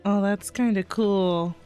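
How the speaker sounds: usual speaking voice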